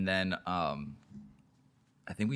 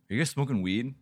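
security guard; 5 semitones higher, 5 dB louder than usual. Retold or imitated. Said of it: "and he goes".

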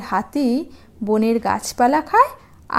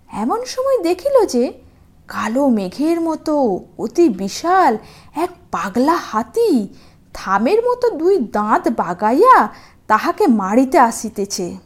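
usual speaking voice

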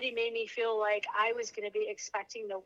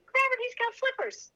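much higher, laughing cry to husband; laughing disbelief; 12 semitones higher, 3 dB louder than usual. Retold or imitated. Mother tells husband about baby's feet, high laughing squeal.

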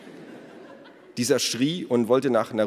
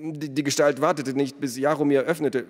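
higher-pitched, hurried confession; nervous accused smith; 6 semitones higher, 3 dB louder than usual. A narrator's reading. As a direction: confess anxiously in a raised voice. (nervöser Schmied, höhere Stimme)

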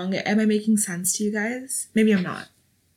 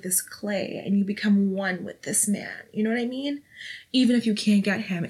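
normal talking voice

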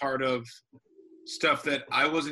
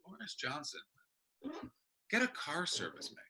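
muttered aside; 3 semitones higher, 11 dB quieter than usual. Retold or imitated. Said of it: "I was like".

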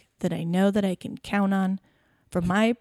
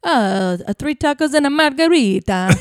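higher, louder mock Italian; hammed-up Italian accent; 5 semitones higher, 7 dB louder than usual. Ordering tacos in a loud mock Italian accent. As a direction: ham it up like a pizza-shop cliché. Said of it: "Uh, Italian".

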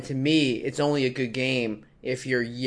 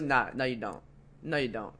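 softer, hurried denial; quiet denial; 9 dB quieter than usual. Retold or imitated. Player denies being recognised, quietly. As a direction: brush it off under your breath.

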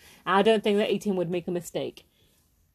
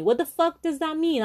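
outraged; 7 semitones higher than usual. Real, not acted. Outraged at instructor's comment.

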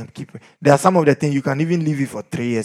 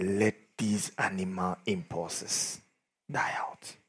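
hushed command; 10 semitones lower, 13 dB quieter, slower than usual. Retold or imitated. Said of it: "He said".

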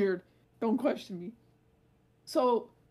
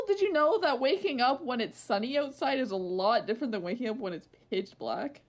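ordinary talk

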